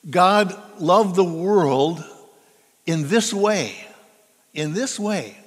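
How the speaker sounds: "God loved the world in this way", "this" stressed. Shown as a demonstration. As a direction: spell out the meaning slowly. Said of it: "means, like".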